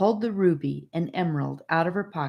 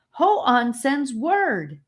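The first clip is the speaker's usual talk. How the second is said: announcing; 9 semitones higher, 4 dB louder, much slower than usual. A narrator's reading. Hymnia breathless announcement.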